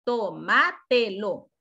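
"Tomátelo" slowly as to, ma, te, lo; slow, split-up teaching demonstration of "Tomátelo"; syllable by syllable. Shown as a demonstration. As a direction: spell out each syllable of "Tomátelo". Teacher breaking the word into syllables.

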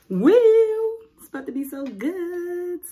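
high, loud excited whoop. Real, not acted. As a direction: cheer at the food. Excited about the food.

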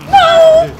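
very high, very loud shriek of no; panicked scream. Real, not acted. Player attacked in the game, panicked scream.

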